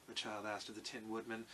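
ordinary delivery, nothing marked

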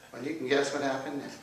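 ordinary delivery, nothing marked

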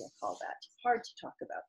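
soft, voiceless whisper; hushed aside. Real, not acted. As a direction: drop to a whisper on a hard topic. Teacher's hushed aside on slavery.

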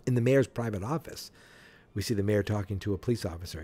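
usual speaking voice

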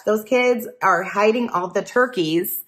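ordinary delivery, nothing marked

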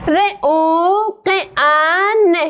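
ر او ق ان, each sound said on its own, very slowly. Shown as a demonstration. Teacher sounding out each letter for pupils, very slow. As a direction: spell out the sounds one by one.

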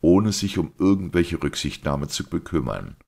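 ordinary delivery, nothing marked

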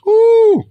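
sharp high squeal after the bite; high ooh of delight. Real, not acted. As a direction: squeal at the first taste. First bite.

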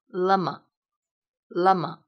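"lama" read very slowly, closed a; slow model reading. Shown as a demonstration. Teacher modeling "lama" slowly for students. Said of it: "第三个".

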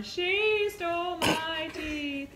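high, slow, woozy mock whine; imitating loopy patient. Wife retelling her drugged husband's rant, high slurred mock whine. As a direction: play the drugged friend after surgery, high and slow. Repeats drugged partner's line.

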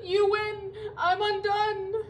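loud, high, drawn-out wailing; blubbering. A narrator's reading. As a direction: sob out a hammed-up surrender. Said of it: "he blubbered".

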